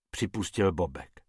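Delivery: usual speaking voice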